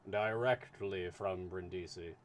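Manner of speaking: calm, measured consul reply. Acted. Consul answers in story.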